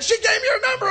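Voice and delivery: high, loud, near-tearful glee; giddy friend. Retold or imitated. The friend bragging about her number, high and giddy. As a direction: squeal it like a kid with a prize. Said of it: "He's like".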